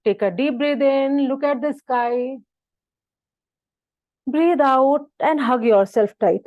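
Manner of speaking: measured yoga-class cueing; guided breathing. Acted. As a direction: draw the words out for a long breath. Leads breathing in drawn-out cues.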